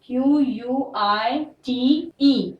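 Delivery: slow letter-by-letter spelling Q यू आई टी ई; dictating spelling slowly; spelling dictation. Shown as a demonstration. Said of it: "ध्यान से लिखिएगा".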